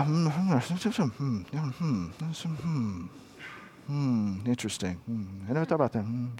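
low, very soft musing hums; murmuring. Shown as a demonstration. Preacher showing an internal dialogue with yourself, low murmur. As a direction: murmur half-words to yourself, deep in thought. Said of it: "it's to murmur".

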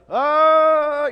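loud high war cry; battle cry. Retold or imitated. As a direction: bellow it like soldiers charging. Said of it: "whatever the battle cry was".

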